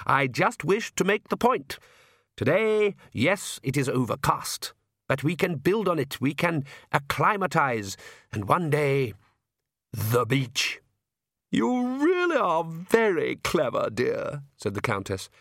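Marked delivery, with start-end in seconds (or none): 0.1-10.8 measured, cheerily lecturing patriarch; genial vampire count. Acted. Count sells his plan to his family, cheerfully pompous. 11.5-14.4 high, very slow, gushing wife; adoring countess. Acted. Countess praises her husband, high and fawning.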